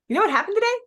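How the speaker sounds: excited teaser. Shown as a demonstration. Coach demos an excited hook for followers. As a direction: hook them with a bright teasing question. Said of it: "you could do".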